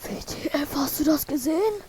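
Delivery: shocked whisper; whispered aside to buddy. Real, not acted. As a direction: hiss it in disbelief. Shocked reaction to video.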